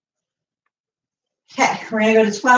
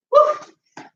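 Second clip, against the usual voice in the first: whoop. Real, not acted. Gasping during exercise.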